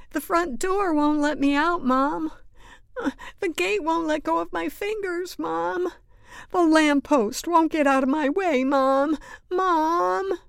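high, loud wail to mom; whiny protest. A narrator's reading. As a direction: kid desperately stalling at full volume. Kitten stalling, calls out for mom.

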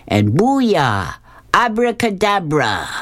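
swooping, high incantation; wizard casting a spell. Acted. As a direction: cast it with a theatrical flourish. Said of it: "he throws his arms out and says".

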